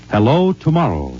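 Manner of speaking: normal talking voice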